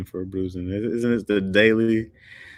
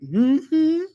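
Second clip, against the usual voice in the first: high-pitched mock-sleepy child; put-on innocent little voice; faking sleep; 4 dB louder, slower than usual. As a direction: imitate a kid feigning innocence on waking. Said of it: "Do that fake sleep?".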